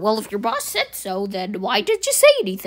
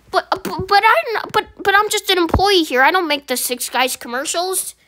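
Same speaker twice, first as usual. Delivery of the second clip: much higher, louder stammering protest; panicked employee; 8 semitones higher, 4 dB louder than usual. Acted. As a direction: blurt it out in a panic.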